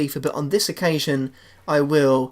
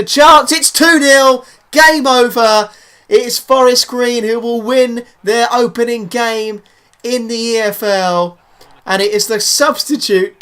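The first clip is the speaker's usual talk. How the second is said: excited goal call; 6 semitones higher, 8 dB louder than usual. Acted. Commentator calls the second goal late on, triumphant shouting. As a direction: explode on the goal, stretch out the big phrases.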